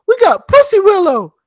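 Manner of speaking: put-on high, loud kid voice; little boys. Boys showing the old man, high and loud. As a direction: announce it proudly like a small boy. Kids answer.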